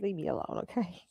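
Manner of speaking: breathy whisper, pushing granny away; whispered brush-off. Retold or imitated. Telling granny to leave her be, whispered. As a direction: hush it like you're waving her off. Retold reply to worried granny.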